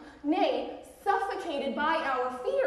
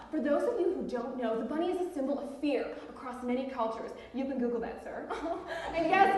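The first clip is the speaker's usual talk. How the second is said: ordinary talk, nothing marked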